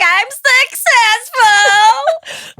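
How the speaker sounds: high, loud, hugely stretched triumphant cry; over-the-top boast. Retold or imitated. Telling her friend how she used to answer, crowing high and slow. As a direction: crow it like a winner. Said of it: "I'm like".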